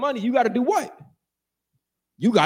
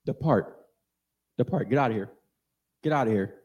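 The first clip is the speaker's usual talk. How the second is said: low, soft voicing of the inner warning; quiet voice; 9 semitones lower, 5 dB quieter, faster than usual. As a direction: speak it low like a whisper from within. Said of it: "that quiet voice".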